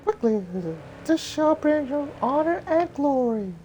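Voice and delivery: put-on very high, breathy young heroine; high girl voice. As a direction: lift into a high girlish falsetto. Mulan's voice.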